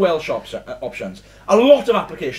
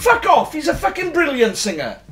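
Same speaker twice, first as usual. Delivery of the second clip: mock outrage; 7 semitones higher than usual. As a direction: defend your idol in playful outrage. Defending [name] in mock outrage.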